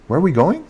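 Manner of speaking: casual question rising on "going"; informal rising question. Shown as a demonstration. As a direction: toss it out, pitch up on "going". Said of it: "almost like in English saying".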